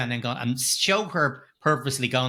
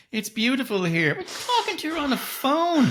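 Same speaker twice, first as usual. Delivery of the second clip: mock gushing daughter; 12 semitones higher than usual. Mocking heroine again.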